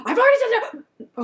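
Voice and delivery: high, loud frustrated yell, half laughing; retold yell. Customer snapping at the support agent, high and loud. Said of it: "I started to yell".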